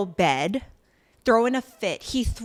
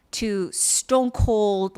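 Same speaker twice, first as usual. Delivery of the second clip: drawn out; much slower than usual. Real, not acted. Recapper marking her change of mood, drawn out. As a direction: stretch STONE COLD right out.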